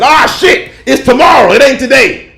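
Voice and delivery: high, loud alarmed yell; panicked shout. Retold or imitated. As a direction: cry it out like you just realized the mistake. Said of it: "and I said".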